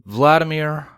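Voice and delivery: plain reading voice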